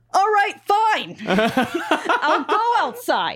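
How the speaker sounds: high, drawn-out mock protest; hammed-up high-pitched grudging agreement. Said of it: "I'm gonna do it in my own voice".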